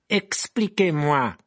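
slow, deliberate demand; menacing teacher. A narrator's reading. Rogue demands Malfoy explain, slow and menacing. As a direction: stretch each syllable with cold authority.

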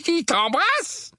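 high, slow, disbelieving question; incredulous exclamation. A narrator's reading. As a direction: echo it in disbelief, rising at the end. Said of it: "s'exclama son oncle, les yeux légèrement exorbités". (question incrédule et aiguë)

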